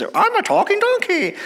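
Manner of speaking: very high falsetto ventriloquist act; nervous owner faking donkey. Acted. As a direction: badly fake a donkey's voice in squeaky falsetto. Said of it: "she gets really nervous".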